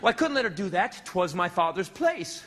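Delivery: ordinary talk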